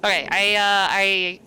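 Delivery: ordinary talk, nothing marked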